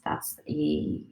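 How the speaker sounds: ordinary delivery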